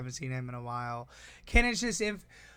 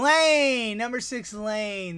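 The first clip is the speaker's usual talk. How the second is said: much higher, much louder shout of a name; excited call; 7 semitones higher, 8 dB louder, much slower than usual. Real, not acted. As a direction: greet him like a big reveal. Calling out friend's name.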